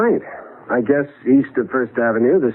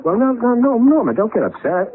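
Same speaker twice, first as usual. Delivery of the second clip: higher, hurried no no to calm her; urgent reassurance; 9 semitones higher than usual. Acted. Husband to frightened wife, higher urgent reassuring. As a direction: rush in to stop her panic.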